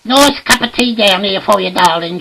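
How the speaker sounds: high, loud housewife call; acted high-pitched domestic shout; shouting upstairs. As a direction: holler it fondly up the stairs. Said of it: "She shouts up the stairs".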